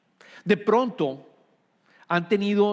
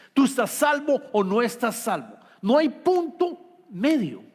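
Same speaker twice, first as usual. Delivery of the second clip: preaching; 4 semitones higher than usual. Acted. Pastor pressing the congregation, building to a forceful peak. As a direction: drive the point home with rising force.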